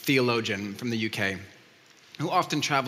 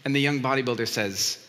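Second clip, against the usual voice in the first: ordinary delivery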